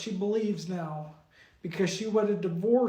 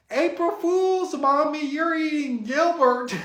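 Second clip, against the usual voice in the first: put-on gleeful kid; 5 semitones higher, 5 dB louder, slower than usual. Caillou springs the prank on Mommy.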